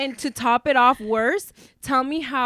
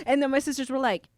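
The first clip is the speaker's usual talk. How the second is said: ordinary talk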